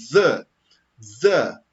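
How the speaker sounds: "the" said twice, tongue between the teeth; two ways to say the. Shown as a demonstration. Teacher showing students both sounds of "the". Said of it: "duas formas de falar o T H".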